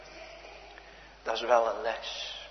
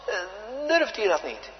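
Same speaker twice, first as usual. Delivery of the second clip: ordinary talk, nothing marked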